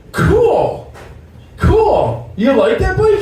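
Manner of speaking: high, excited cool. Retold or imitated. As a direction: gush over the bike. Excited reaction to the bike.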